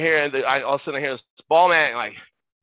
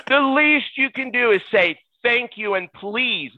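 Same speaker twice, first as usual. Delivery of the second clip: retold telling-off; 9 semitones higher, slower than usual. Acted telling-off of the player.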